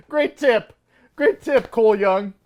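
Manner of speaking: high-pitched mock gratitude; sarcastic praise. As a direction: praise it as if it were useful, laughing at it. Mocking a useless warning in film.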